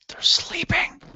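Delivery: hushed whisper so as not to wake sleepers. Acted. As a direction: whisper it protectively. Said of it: "why are we whispering".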